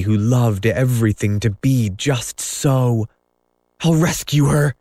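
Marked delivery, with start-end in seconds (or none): 3.8-4.7 higher, rousing vow; spring to the rescue; a boyish cry, higher pitched; heroic cry. A narrator's reading. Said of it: "he cried".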